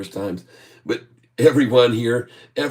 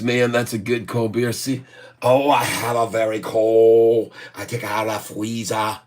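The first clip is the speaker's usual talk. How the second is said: ordinary talk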